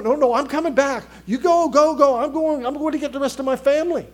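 loud, high and hurried; urgent excitement. Acted. Preacher voicing a man rushing to fetch his family, urgent and excited. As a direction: act out someone dashing off to fetch everyone.

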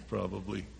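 normal talking voice

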